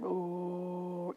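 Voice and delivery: ordinary delivery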